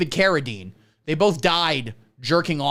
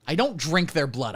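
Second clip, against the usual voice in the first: usual speaking voice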